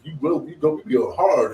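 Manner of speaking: sassy, upbeat hype voice; hype-up encouragement. Retold or imitated. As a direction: cheer her on, pitched well up. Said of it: "that's how I would ... talk to her".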